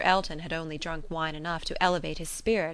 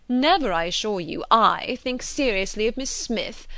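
loud, emphatic protest; offended denial with a raised voice; affronted; 4 semitones higher, 6 dB louder than usual. A narrator's reading. Suitor scorns match with Miss Smith, affronted. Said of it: "affronted in his turn".

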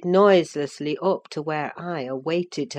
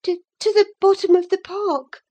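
put-on much higher girlish stammer, quick; 5 dB louder, faster than usual. A narrator's reading. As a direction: stumble on the first word, caught out. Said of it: "she stammered".

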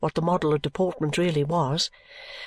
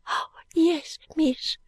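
put-on high, slow child's voice; earnest small maid promising gratefully; pitched-up, moved little servant girl; 9 semitones higher than usual. Little maid keeps answering mistress.

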